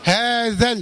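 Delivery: "هذا ال" with ذ going directly to a sakin lam; short ذ vowel in "هذا ال", alif dropped; straight into lam. Shown as a demonstration. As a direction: link "هذا ال" as hādhal. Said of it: "بعده لام الساكنة على طول".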